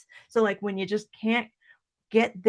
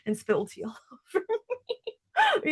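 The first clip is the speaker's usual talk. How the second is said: laughing through the words; breaking into a giggle; 9 semitones higher than usual. Real, not acted. Laughing at spilling tea.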